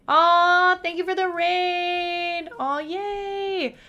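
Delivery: high, drawn-out squeal of thanks; big sing-out cheer; delighted thanks. Real, not acted. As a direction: gush loudly, stretching the vowels high.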